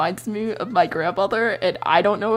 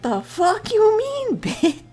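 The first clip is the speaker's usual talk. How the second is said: much higher and slow, disbelieving; incredulous outburst; slower than usual. Retold or imitated. Bear answering the demand, high and slow in disbelief. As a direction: stretch out the disbelief. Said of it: "Bear's like".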